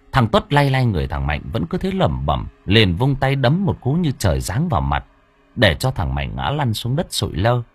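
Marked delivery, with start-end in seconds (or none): none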